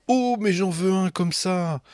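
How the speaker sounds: high, gushing delight; émerveillement; thrilled mom. A narrator's reading. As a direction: swoon over the llama like an excited mom. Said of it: "maman tombe en émoi".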